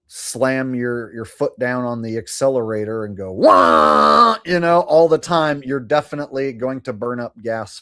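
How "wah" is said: loud low "wah" like flooring it; revving yell. Retold or imitated. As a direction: belt out a gas-pedal whoop. Mimics flooring the accelerator.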